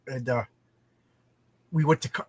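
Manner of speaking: ordinary talk, nothing marked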